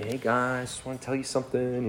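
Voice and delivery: soft, polite mock greeting; a hint of a meek do-gooder. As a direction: imitate a timid, overly polite opener. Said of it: "he goes up there real polite".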